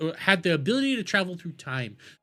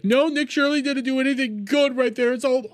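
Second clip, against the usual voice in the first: lampoon him as a shrill partisan; mocking the pundit; 7 semitones higher than usual. Ranting vlogger mocks a pundit's Minnesota take, higher whiny voice. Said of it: "he's like".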